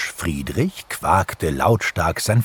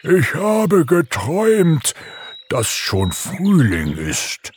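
slow, drawn-out drowsy bear voice; sleepy bear; 11 semitones higher, slower than usual. A narrator's reading. Bear Umberto tells his dream on waking, sleepy. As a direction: drag it out, only half awake. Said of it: "murmelte Umberto verschlafen".

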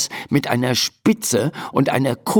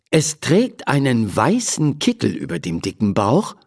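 ordinary delivery, nothing marked

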